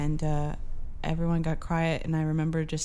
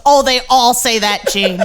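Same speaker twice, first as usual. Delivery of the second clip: dismissive dad voice; 6 semitones higher, 12 dB louder than usual. Retold or imitated. Dad brushes off the correction.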